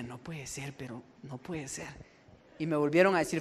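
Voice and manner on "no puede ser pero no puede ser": hushed, much softer, disbelieving; stunned disbelief. Retold or imitated. Francisco reliving hearing his name, hushed disbelief. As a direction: murmur it as if you can't believe it.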